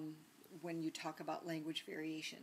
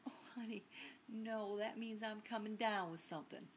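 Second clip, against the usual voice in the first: put-on Southern roommate's accent; 4 semitones higher than usual. Roommate explaining a local phrase to a Northerner, imitated Southern accent. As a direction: gently set them straight, imitating a Southern accent. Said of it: "when I lived in the South, my roommate says".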